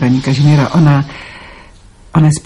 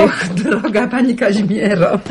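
laughing greeting; 6 semitones higher, 3 dB quieter than usual. Acted. Neighbour greets warmly with laugh.

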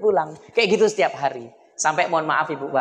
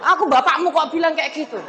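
angry father; 10 semitones higher than usual. Retold or imitated. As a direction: snap back as an offended father. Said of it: "Lu marah bapaknya".

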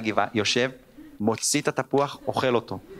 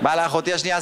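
usual speaking voice

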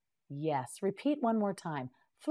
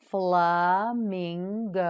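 slow model word; much slower than usual. Shown as a demonstration. Teacher to students, modelling the word very slowly. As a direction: stretch flamingo slowly, syllable by syllable. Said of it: "You say it".

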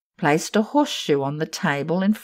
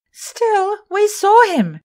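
put-on high, loud young girl's voice; insist, frightened; 6 dB louder, slower than usual. Germaine insists to Sorelli, high and frightened. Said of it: "Germaine declared".

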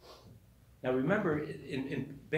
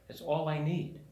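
ordinary talk

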